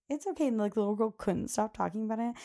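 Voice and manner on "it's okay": high, bright mom voice; reassuring mom. Retold or imitated. Host imitates a mom hushing her daughter, higher. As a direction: soothe a child quickly. She's like.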